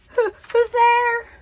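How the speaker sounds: high, loud, drawn-out stammering fear; frightened Rabbit. A narrator's reading. Lost Rabbit calls into mist, terrified. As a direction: wail it out in acted terror. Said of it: "his frightened mind playing tricks".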